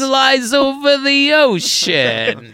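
high, slow hammed-up New York crooner; old-timey New York voice, drawing out each word; mock New York cartoon character; Rocket, New York. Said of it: "Universal Hollywood New York's".

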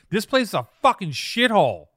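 just blurt it out loud; blunt loud insult. Retold or imitated. Maddie walking in, blunt loud insult. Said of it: "Maddie just comes in and goes".

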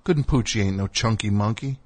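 ordinary delivery

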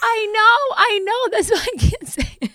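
giggling through the words; can't stop laughing. Real, not acted. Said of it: "laughing at own mispronunciation".

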